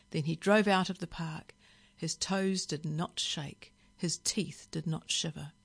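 ordinary delivery, nothing marked

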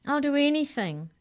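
high, loud desperate promise; gasped plea. A narrator's reading. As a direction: plead desperately, pitched high. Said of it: "gasped Molly".

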